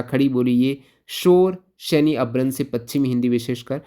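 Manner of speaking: usual speaking voice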